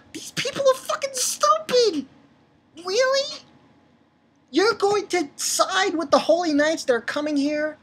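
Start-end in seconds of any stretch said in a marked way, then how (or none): 0.1-6.8 much higher, incredulous; exasperated high rant. Real, not acted. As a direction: squeal it in disbelief. Ranting at manga characters.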